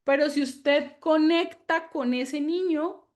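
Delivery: ordinary talk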